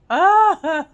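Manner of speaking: loud high squeal of delight, mock-overwhelmed; fangirl squeal. Fan reacting to Keith protecting Karen, delighted squeal. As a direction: let out a giddy shriek as if it's too much. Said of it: "And I'm just like".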